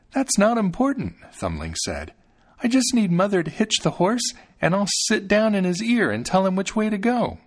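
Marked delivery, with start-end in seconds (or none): 0.1-1.2 high little-fellow voice; confident tiny boy. A narrator's reading. Thumbling reassures his doubting father. 2.6-7.4 put-on high-pitched tiny child voice; eager, confident Thumbling. A narrator's reading.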